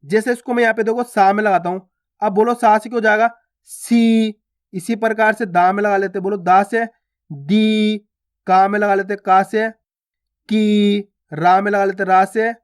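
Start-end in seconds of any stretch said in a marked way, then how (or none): 3.6-4.3 सी with a long drawn-out ई; long see. Shown as a demonstration. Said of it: "उच्चारण खींच के किया जाता है". 7.3-8.0 दी with a long drawn-out ई; long dee. Shown as a demonstration. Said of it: "खींच खींच के उच्चारण कर देना". 10.5-11.0 की with a long drawn-out ई; long kee. Shown as a demonstration. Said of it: "खींच खींच के उच्चारण कर देना".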